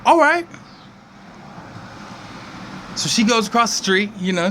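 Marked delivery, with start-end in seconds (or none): 0.0-0.5 put-on excited little girl. Susie agrees excitedly.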